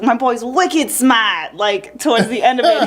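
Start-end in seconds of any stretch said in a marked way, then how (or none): 0.0-1.5 put-on drawn-out, higher Boston-accented brag; hammed-up Boston accent, stretched out slow; screamed Boston movie line. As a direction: imitate a Boston guy crowing about his buddy. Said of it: "he just screams it to him".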